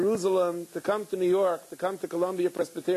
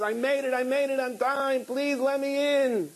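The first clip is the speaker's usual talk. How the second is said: higher, breathless pleading at the door; desperate plea; 8 semitones higher than usual. Retold or imitated. Father arriving late, pleading at the door to be let in, high and urgent. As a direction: beg to be let in, pitch climbing high. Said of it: "He's all sweating".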